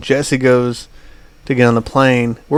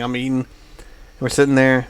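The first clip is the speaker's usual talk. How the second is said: ordinary talk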